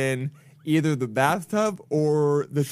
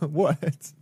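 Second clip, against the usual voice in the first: ordinary delivery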